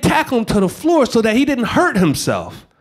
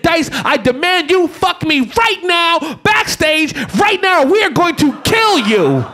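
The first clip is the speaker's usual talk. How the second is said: higher, shouted mock demand; mock demanding Kim; 7 semitones higher than usual. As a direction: bark the demand like a diva. Kim Kardashian voiced, shouted demand.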